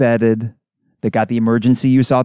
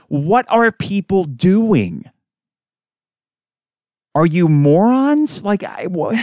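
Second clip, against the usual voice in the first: slow, high, disbelieving demand; exasperated rant; 8 semitones higher, much slower than usual. Real, not acted. Podcaster venting at people still spreading covid, exasperated. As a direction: drag it out in disbelief at the public.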